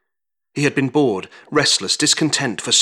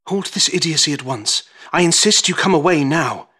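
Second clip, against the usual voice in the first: ordinary delivery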